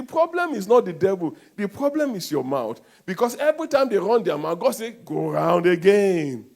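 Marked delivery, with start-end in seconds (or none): 4.9-6.5 drawn out and louder; God sending them around again; slow booming decree; slow loud God command. Acted.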